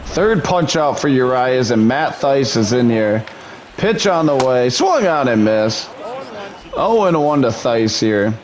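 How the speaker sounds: plain. Acted.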